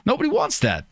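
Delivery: halting celebrity impression with odd pauses and jumps in pitch; Christopher Walken. Streamer refusing the requested impression while doing it, halting Walken voice. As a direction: break the sentence up in strange places, as an impressionist would. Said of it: "Do Christopher Wa-, you can do Christopher Walken".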